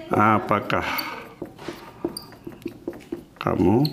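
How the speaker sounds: each word with a long pause; slow dictation, one word at a time. Shown as a demonstration. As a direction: spell out "Apakah kamu punya uang?" word by word with pauses.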